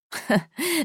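brief high chuckle, rising; demon's short laugh. A narrator's reading. Demon lord amused by the maid's offer, chuckling. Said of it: "Asmodes lachte".

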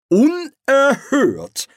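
high, slow indignant exclamation; outraged outburst. Acted. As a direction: blurt it out in shock. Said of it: "entfuhr es Lord Rust".

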